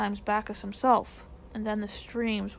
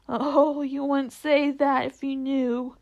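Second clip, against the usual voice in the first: slow, loud acted lament; between sobs; 3 semitones higher, 6 dB louder, slower than usual. A narrator's reading. Fawn rejecting Lucy's praise, tearful lament. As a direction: wail it out slowly as if sobbing. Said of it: "replied between his sobs".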